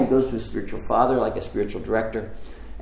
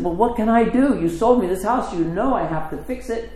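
higher-pitched, pleading objection; exasperated protest; 8 semitones higher than usual. Retold or imitated. Buyer answering the woman who sold the house, exasperated. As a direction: plead your case to the seller. Acted protest to yelling neighbor.

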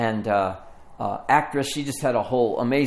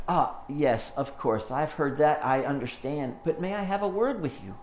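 softer, soothing retold reply; patient calming; 4 dB quieter than usual. Older woman answers the ranting rapper, patient and gentle. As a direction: humour him gently, then ask. Angelou calms an angry rapper.

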